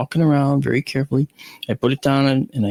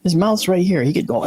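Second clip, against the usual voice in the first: usual speaking voice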